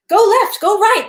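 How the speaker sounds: loud, high battlefield shout; high urgent shout of soldiers; shouted orders. Acted. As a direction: yell the directions over gunfire.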